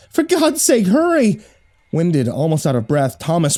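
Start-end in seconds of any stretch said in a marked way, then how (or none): 0.1-1.4 put-on high, desperate girl's plea; pleading girl. A narrator's reading. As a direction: beg her to hurry.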